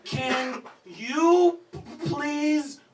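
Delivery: high, loud, slow begging; whiny plea to his wife; pleading whine. Retold or imitated. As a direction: beg tearfully word by word. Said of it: "And I'm like".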